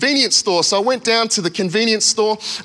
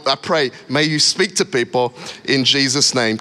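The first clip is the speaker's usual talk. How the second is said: ordinary talk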